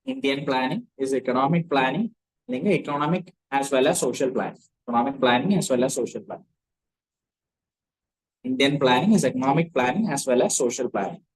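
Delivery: slow, spaced dictation with pauses between phrases. Acted. Teacher dictating notes to the class, slow and spaced. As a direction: read each phrase out slowly so they can copy it down.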